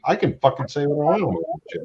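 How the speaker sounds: indignant fast protest; defiant outburst. Real, not acted. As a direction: fire it back defiantly. Repeats defiant swearing line.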